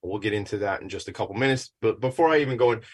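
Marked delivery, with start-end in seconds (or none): none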